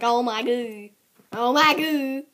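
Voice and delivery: loud excited squeal. Real, not acted. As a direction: freak out with joy, loudly. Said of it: "I'm flipping out".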